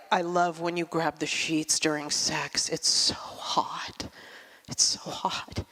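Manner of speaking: mock sultry husband, low, soft, ending in a whisper; husband's bedroom voice. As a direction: play the husband murmuring then whispering in bed. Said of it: "he'll say things like".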